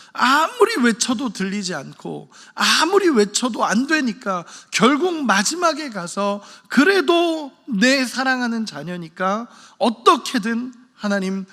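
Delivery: slow, high-pitched emphatic pleading; impassioned preaching. Acted. Preacher conveying a father's heart for unheeding children, impassioned and drawn-out. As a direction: stretch the key words as if aching to be heard.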